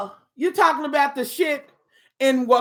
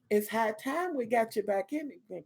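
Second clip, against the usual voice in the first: ordinary delivery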